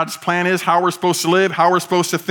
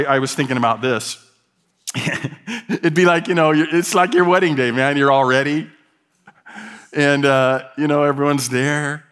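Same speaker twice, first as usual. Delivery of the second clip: ordinary talk, nothing marked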